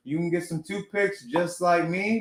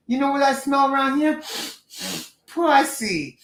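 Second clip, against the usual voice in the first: put-on quoting a movie line in an East Coast accent, pitched higher; 10 semitones higher than usual. To his co-host, quoting House Party in an East Coast accent. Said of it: "I took that from the movie House Party".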